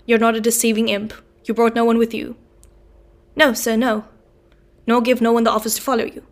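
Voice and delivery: convict barks suspicious questions loudly; sudden loud demand. Acted. Convict suddenly demanding the boy answer, loud. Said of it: "and he said suddenly".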